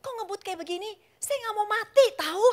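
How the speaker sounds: wife yelling at the reckless driver; retold shout. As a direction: snap at him in the speeding car. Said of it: "Saya bilang gitu".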